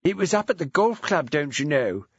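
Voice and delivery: a hint of posh; lightly voiced posh lady. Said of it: "She put on her posh voice".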